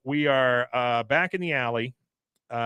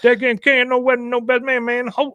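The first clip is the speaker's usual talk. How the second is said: Patch impression; 11 semitones higher, 7 dB louder, faster than usual. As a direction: whine it like Patch. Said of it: "from Patch".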